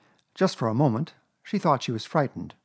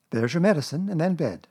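raised mother's instruction; brisk motherly Kanga; 6 semitones higher, 3 dB louder than usual. A narrator's reading. Kanga giving medicine before bed, motherly and brisk. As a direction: lay out the bedtime routine, pitched up.

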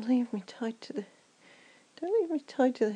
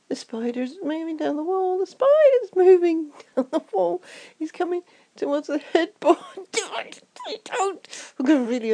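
panicky; 4 semitones higher, 8 dB louder than usual. Acted. Panicking about approaching spider.

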